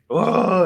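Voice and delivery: high, loud mock groan; imitated labour groan. Wife in the corner going into labor, imitated loud groan.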